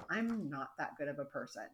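quietly voiced guilty Jordan; quiet, low guilty confession. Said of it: "And Jordan's like".

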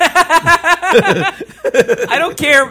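high, very slow, laughing drawl. Real, not acted. Brushing off the comedy question, laughing drawl. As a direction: stretch it out through laughter.

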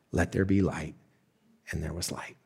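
soft and low, letting it fade out; hushed awe. Acted. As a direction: say it with quiet wonder. Creation verse, climax.

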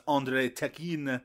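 "Andrei Tekin" with a hard k in the surname; mock mispronunciation. Co-host teasing the host's pronunciation, mock mispronunciation. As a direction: mimic a botched "Andrei Tekin", k for sh. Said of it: "better than how you pronounce".

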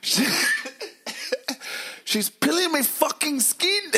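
high, loud, drawn-out mock shriek; screaming cartoon potato. Imitating a peeled potato from the trailer, mock shriek. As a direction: shriek it slowly like terrified food. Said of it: "Have you seen the preview".

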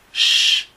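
a long, loud breathy "sh"; sh sound demo. Shown as a demonstration. Teacher demonstrating the second column's sound, loud hushing demo. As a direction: hiss out "sh" strongly. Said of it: "el sonido de la S H".

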